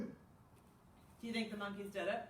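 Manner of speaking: usual speaking voice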